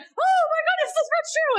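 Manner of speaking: very high, breathless amazement; hooked listener gasp. Pretend. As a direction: squeal as if you can't wait to know. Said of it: "they're gonna be like".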